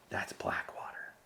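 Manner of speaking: hushed awed whisper; conspiratorial whisper. Retold or imitated. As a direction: whisper it like a secret. Others name the secretive contractors.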